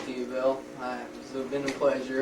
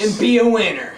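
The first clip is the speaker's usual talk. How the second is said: ad tagline; 7 semitones higher, 14 dB louder than usual. Pretend.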